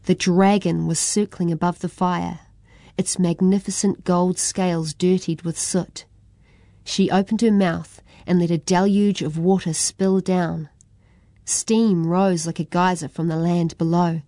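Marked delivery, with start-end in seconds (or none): none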